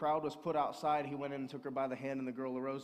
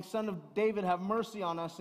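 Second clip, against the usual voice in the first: mild retold cry; 6 semitones higher, 6 dB louder than usual. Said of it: "cried out".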